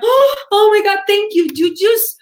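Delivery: high, loud, gushing thanks; breathless-with-joy reaction, played up; acted overjoyed gratitude. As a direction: gush as a delighted teacher. Teacher's acted reply to grateful student.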